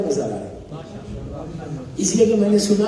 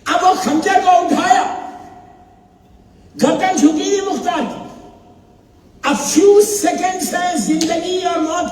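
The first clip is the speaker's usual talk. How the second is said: climactic sermon narration; 8 semitones higher than usual. Acted. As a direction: build the suspense to the breaking point. Climax of the majlis story.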